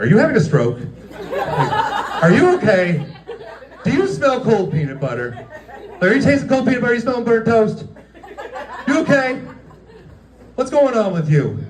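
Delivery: high, incredulous questioning; exaggerated high worried voice; mock alarmed concern. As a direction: pitch it up as if checking on someone unwell. Acted mock concern for the boss.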